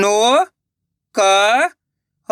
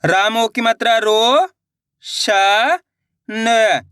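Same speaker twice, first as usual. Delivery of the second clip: slow, deliberate sounding out; teacher sounding out a syllable for kids; slow explanation; much slower than usual. Shown as a demonstration. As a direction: spell out the vowel sign slowly. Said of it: "चलिए देखिए".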